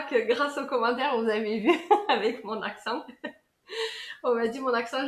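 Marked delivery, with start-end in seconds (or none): 1.2-3.0 laughing. Real, not acted. Laughing about her accent. 3.7-4.2 high giggle. Real, not acted. High laugh after accent remark.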